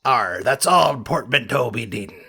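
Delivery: lightly voiced pirate growl; a hint of a pirate, arr; mild pirate accent. Pirate character talking.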